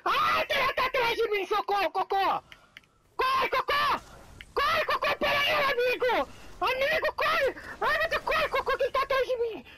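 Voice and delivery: high, panicked shouting for help; being chased. Real, not acted. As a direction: yell for your friend to run. Enemy chasing him in game.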